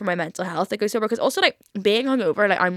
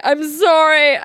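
mock tearful wail, louder and higher; crying retold; 7 semitones higher, 6 dB louder, slower than usual. Leaving an argument for the hairdresser's, mock crying. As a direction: imitate a loud, high crying apology. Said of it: "running out the door crying".

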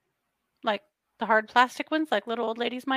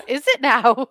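laughing; 7 dB louder than usual. Real, not acted. Mocking the promise, laughing.

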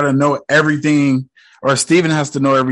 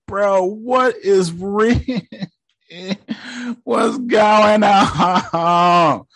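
high, slow, incredulous, breaking into a laugh; baffled; 8 semitones higher, much slower than usual. Real, not acted. Viewer reacting to the twist, high and disbelieving. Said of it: "I'm like".

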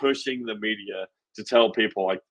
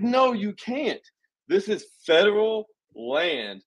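much higher, emphatic comeback, slowing down; retorting to online critics, much higher and slower; insistent retort; 9 semitones higher, slower than usual. Retold or imitated. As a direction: shoot it back high and slow, hammering the point. Retelling an argument with critics.

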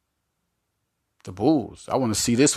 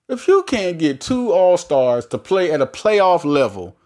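ordinary talk

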